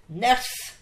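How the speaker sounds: loud, high call of the name. Retold or imitated. Night supervisor catching the new nurse, loud call. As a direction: call her sharply from the door.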